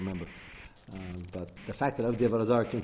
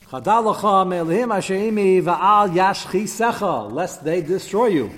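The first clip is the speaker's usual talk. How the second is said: declaimed verse; 6 semitones higher, 9 dB louder than usual. Retold or imitated. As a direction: proclaim the pasuk to the room at full voice.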